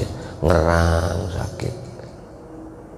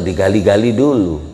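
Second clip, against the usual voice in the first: ordinary talk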